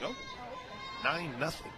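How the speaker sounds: hushed mock realization; quiet, understated "oh" of a fan; imitated fan reaction. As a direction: softly voice a latecomer noticing the score. Voices late-arriving fans seeing score.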